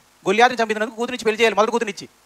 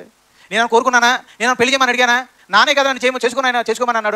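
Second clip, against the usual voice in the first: raised, pleading lament, higher and louder; Leah's anguished cry; 5 semitones higher, 4 dB louder than usual. Acted. Preacher voicing Leah's grief to the congregation, anguished pleading. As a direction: cry out as the unloved wife who did nothing wrong.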